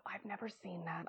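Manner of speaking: sheepish aside, almost whispered; hushed confession. Real, not acted. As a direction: admit it under your breath. Hushed confession of unseen film.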